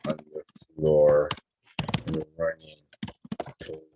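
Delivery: word-by-word story composing, long pauses. Real, not acted. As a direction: dictate it haltingly, as if writing along.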